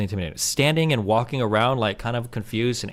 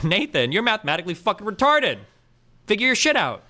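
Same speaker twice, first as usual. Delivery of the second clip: derisive berating; 7 semitones higher than usual. Shown as a demonstration. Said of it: "One of those things is abusive".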